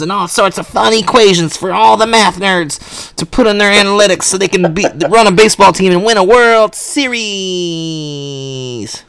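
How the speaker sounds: nerd voice. Acted. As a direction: gush like an excited math nerd. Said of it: "That's my nerd voice".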